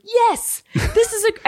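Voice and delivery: high put-on caller voice; mock prank-call voice. Imitating his partner's high prank-call voice. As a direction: pitch way up like a fake caller. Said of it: "doing prank phone call voices".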